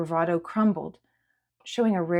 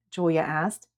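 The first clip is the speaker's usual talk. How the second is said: usual speaking voice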